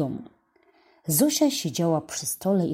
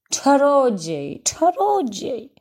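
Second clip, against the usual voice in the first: slow, higher, wondering; amazed whisper-thought; 7 semitones higher, 3 dB louder, slower than usual. A narrator's reading. Boy marvelling at the magic word, slow and wondering. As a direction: marvel at the old man's magic. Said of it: "pomyślał".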